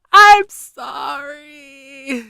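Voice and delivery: drawn-out giggly sorry; sorry said through a laugh; laughing apology. Real, not acted. Laughing at herself to her viewers, drawn out.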